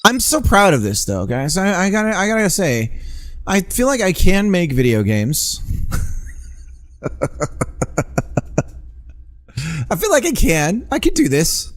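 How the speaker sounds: high, bubbly burst of pride; excited bragging. Real, not acted. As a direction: gush about your own work. Proud of his game code.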